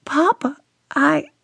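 high, hesitant daughter's voice; a frightened daughter starting to explain; faltering plea. A narrator's reading. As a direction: stumble over the words.

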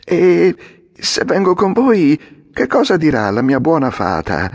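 high puppet voice, wavering; a puppet half-convinced, thinking of the fairy; softening puppet. A narrator's reading. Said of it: "cominciava a intenerirsi". (Pinocchio che si intenerisce, incerto)

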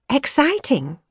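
put-on young woman musing aloud; young woman's voice. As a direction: voice her bright and searching. Her word that he mocks.

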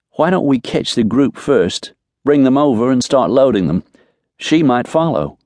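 lifted pitch for the woman's lines; woman helping with horses. Acted. Voices the woman character in dialogue.

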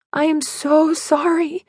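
high, slow, pained apology; tearful apology. A narrator's reading. To Tyler through tears, high sorrowful apology.

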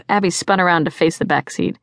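ordinary delivery

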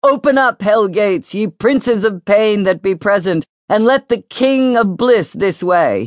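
put-on young actor declaiming his hell-gate lines with grand gestures; theatrical recitation. A narrator's reading. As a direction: proclaim it like a mystery-play actor. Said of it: "he recited theatrically".

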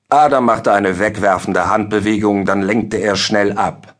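ordinary delivery, nothing marked

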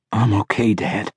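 faint croaky reply, fading away; weak croak. A narrator's reading. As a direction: barely get it out, weakly. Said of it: "he said weakly, little more than a croak".

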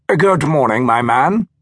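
a hint of a clipped BBC accent; lightly put-on BBC English; mild posh BBC English greeting. A narrator's reading. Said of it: "in his best B B C accent".

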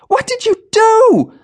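very high, loud, slow horrified scream. A narrator's reading. Jenny screaming at Darren after the shooting, horrified scream. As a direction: scream in shock at the killing. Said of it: "Jenny screamed from behind the counter".

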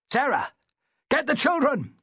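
high-pitched urgent order; urgent calling out. A narrator's reading. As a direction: call to your wife over the storm. Master calls wife during shipwreck.

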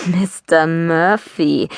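slow, mocking echo of Mister Murphy; drawn-out sneering repeat of her words. A narrator's reading. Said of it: "sagte er spöttisch".

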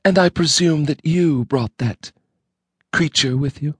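lightly voiced cold, stern wife; polite but freezing, a hint of threat; icy wife. A narrator's reading. Wife coldly demanding an introduction, lightly icy.

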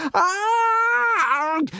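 long, very high terrified yell; high drawn-out "argh"; yelled scream. A narrator's reading. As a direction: scream before the crash. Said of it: "yelled Hiccup".

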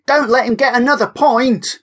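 high cheering shout. Acted. Kira urges Jessica to chase Oliver, cheering shout. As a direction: cheer her on across the field. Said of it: "Kira shouted".

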